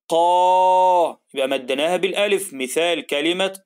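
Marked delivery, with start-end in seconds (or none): 0.1-1.2 قا held long and louder; stretched قا. Shown as a demonstration. Teacher stretching قا for the class, louder. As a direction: draw out قا with a long a. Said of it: "يلا نمدها".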